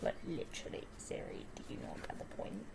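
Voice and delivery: fast, annoyed whisper; exasperated whisper. Real, not acted. Girl fed up with Siri mishearing, exasperated whisper. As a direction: mutter your frustration at the phone.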